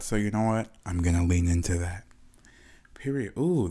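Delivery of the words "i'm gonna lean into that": low, godlike voice leaned into; vast deep cosmic voice. Pretend. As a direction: speak like a deity from above. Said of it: "People say I have a voice like a god".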